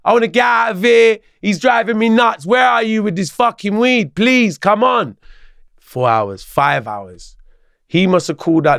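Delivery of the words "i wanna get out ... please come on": pleading and furious at once, dragging the words; going mad. Retold or imitated. Teller on the phone to his late friend, exasperated and pleading. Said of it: "I'm going mad on my friend".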